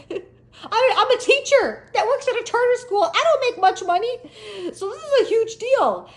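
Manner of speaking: high, loud tearful wail; mock crying. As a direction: blubber through it, hammed up. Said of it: "you know, that crying".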